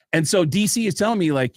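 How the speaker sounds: usual speaking voice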